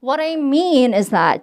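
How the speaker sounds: slow model line leaning on mean; exaggerated stress on mean for practice. Shown as a demonstration. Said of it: "해볼게요".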